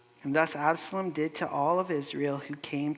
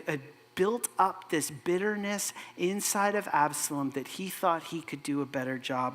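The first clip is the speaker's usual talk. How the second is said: ordinary talk, nothing marked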